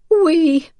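high, breaking with grief; broken voice. A narrator's reading. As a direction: voice cracking on the word. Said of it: "Rachel said brokenly".

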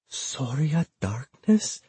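slow, hushed, fearful question; whisper. A narrator's reading. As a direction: whisper it slowly, pitch lifting. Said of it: "Judith whispered".

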